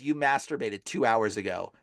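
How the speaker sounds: low, lightly voiced demon. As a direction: drop low for a demon's accusation. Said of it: "the demon starts laughing".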